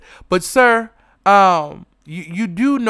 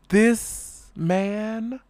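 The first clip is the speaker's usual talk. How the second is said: usual speaking voice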